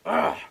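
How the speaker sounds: loud fed-up groan; exasperated ugh. Real, not acted. Streamer sick of it all after a rant, exasperated. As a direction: let out a real groan of disgust.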